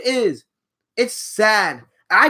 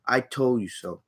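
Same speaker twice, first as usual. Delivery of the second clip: soft, low told you so; quiet smug aside; muttered told you so; 8 semitones lower, 8 dB quieter than usual. Real, not acted. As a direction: say it under your breath. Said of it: "I'm gonna be here to tell you".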